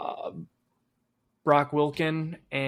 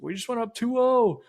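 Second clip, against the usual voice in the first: high, loud, thrilled report; excited; 10 semitones higher, 4 dB louder than usual. Retold or imitated. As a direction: shout the score with glee. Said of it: "And I was like".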